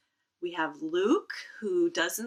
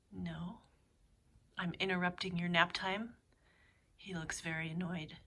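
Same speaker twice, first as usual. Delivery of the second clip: hushed, much softer voice; 7 dB quieter than usual. Real, not acted. Librarian introduces her sleeping cat to the kids, hushed. Quietly visiting a napping cat.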